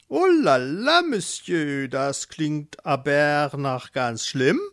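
hohes, gezogenes Oh la la mit französischem Anflug; französelnder Flamingo. Acted. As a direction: wie ein eitler Flamingo, der sich französisch gibt. (Flamingo bedauert Erwins Not, hoch und französelnd)

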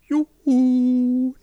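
high, loud, drawn-out yoo-hoo; cheerful call. Acted. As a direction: hail him merrily from afar. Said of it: "Fröhlich rief sie ihm zu". (fröhlich und laut rufen)

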